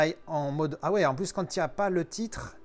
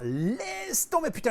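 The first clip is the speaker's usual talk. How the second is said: amazed; 12 semitones higher, slower than usual. Real, not acted. Amazed by the depth effect.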